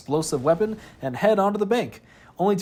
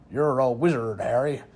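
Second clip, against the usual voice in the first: put-on a lower, half-hearted stab at Hagrid's West Country accent; failed Hagrid accent; 4 semitones lower than usual. Narrator quoting Hagrid telling Harry, weak West Country accent. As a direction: try the accent and give up halfway. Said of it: "I can't do the accent".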